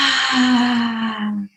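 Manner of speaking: long open "ah" on the out-breath; voiced exhale sigh. Shown as a demonstration. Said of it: "Make a little sound with the exhale".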